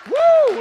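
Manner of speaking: high, loud whoop; joyful whoop. Real, not acted. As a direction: let out a celebratory woo. Preacher whoops after shout.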